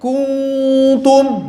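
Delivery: kuntum with a heavy, thick kaf; thickened kuntum. Shown as a demonstration. Teacher imitating the wrong thick kuntum. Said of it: "atau malah ditebalin".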